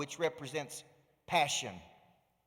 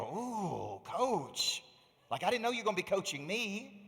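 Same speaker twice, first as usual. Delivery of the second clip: taken-aback parents; 4 semitones higher than usual. Retold or imitated. Parents reacting to coach's hard question, mildly taken aback. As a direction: lightly imitate parents caught off guard.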